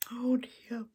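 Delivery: usual speaking voice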